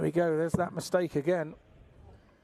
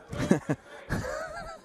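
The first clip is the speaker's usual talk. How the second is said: hearty laugh. Real, not acted. Pundit laughs at own joke.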